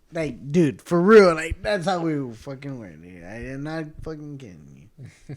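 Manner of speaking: ordinary talk, nothing marked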